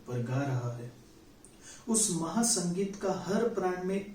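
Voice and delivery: measured, solemn reading of a Hindi poem with lingering refrains; poem recitation. Acted. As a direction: recite it like a stage poet.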